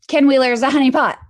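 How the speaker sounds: loud, fast, high declaration; emphatic shout. Real, not acted. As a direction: shout the name out. Repeating the claim at full volume.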